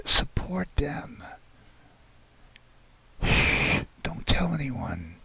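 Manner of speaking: hushed whisper; right in your ear. Pretend. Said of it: "I'm gonna whisper it".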